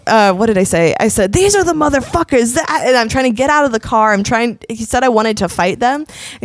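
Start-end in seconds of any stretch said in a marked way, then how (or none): 1.3-2.5 high-pitched mock yell; retold drunk scream. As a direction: re-enact a fired-up drunken call-out.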